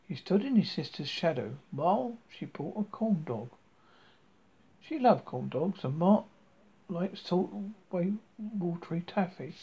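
ordinary delivery, nothing marked

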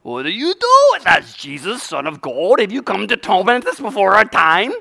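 hammed-up demon pleading; demonized man. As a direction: cry out like a possessed man confronting Jesus. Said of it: "Started talking to him".

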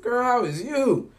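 high, loud and drawn-out hello; excited greeting. Retold or imitated. Meeting his friend by chance, slow excited greeting. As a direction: greet her, thrilled to see her. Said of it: "I was like".

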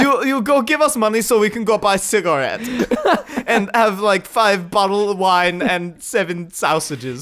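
put-on comic broken-English German kid; mock German accent. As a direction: list demands in hammed-up German accent. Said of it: "the crew was mostly German".

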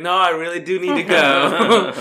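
loud, high retold refusal; imitated firm goodbye, loud and laughing; laughing mock insistence.

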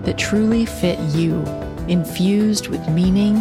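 measured, low-key intro narration; podcast intro read. Acted. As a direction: read it like a show opener. Scripted podcast intro over music.

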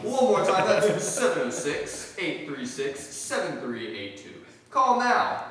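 loud, high, pushy ad pitch; infomercial voice. Pretend. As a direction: fake urgent rush. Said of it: "back from that infomercial".

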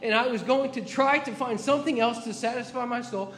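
impassioned preacher, building; fervent preaching. Acted. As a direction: preach it with rising passion. Preaching at full force.

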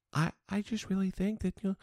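soft, prim, sugary mimicry of a spokeswoman; mock demure voice. Host mocking the spokeswoman's polite tone, soft and demure. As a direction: coo it politely, mocking her. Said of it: "talk in a really soft, quiet, oh, very demure, feminine voice".

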